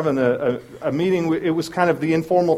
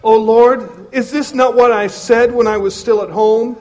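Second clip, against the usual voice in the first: a touch of aggrieved Jonah, higher and louder; Jonah complaining; 6 semitones higher, 6 dB louder than usual. Acted. Pastor reading Jonah's angry prayer to God, lightly aggrieved. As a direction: complain to God like a sulking prophet.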